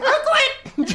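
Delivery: very high-pitched mock scream, no real volume; hammed-up delirious scream held back in volume. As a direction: imitate a manic outburst in a squeaky yell. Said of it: "He just starts screaming".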